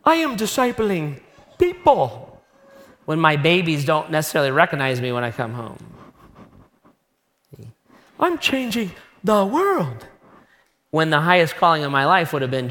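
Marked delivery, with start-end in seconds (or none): 0.0-2.4 higher, slow, self-satisfied sing-song; mock smugness. Preacher mocking his younger self, smug. 8.2-10.1 higher, slow, self-satisfied sing-song; mock smugness. Preacher mocking his younger self, smug.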